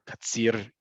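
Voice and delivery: Katzir with a tongue-tip rolled R at the end; rolled Resh. Shown as a demonstration. Teacher listing ways to say Resh, rolled R in Katzir. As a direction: trill the final R of Katzir. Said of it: "you can say Katzir".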